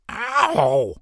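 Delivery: high yelp of pain; screeching ow. Acted. Stunned bat screeches in pain. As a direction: squeal as it hits. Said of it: "it screeched".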